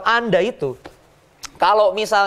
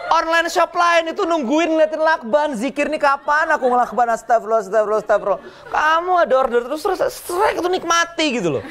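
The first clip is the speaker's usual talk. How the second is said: higher, laughing mock lament; exasperated seller; 9 semitones higher than usual. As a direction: whine like a seller tired of orders. Acting a grumbling seller.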